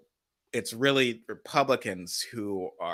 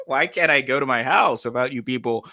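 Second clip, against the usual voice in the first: mock Alito complaint; 4 semitones higher, 8 dB louder than usual. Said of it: "Alito being like".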